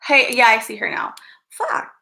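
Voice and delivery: normal talking voice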